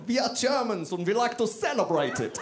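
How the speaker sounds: high, excited mock-German voice; German accent. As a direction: put on a gleeful, high-pitched German accent. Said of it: "that's my German accent".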